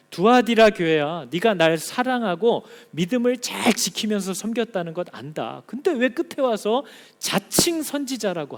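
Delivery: stern reproachful voice of God; God rebuking the church. Acted. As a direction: call the church to account as God.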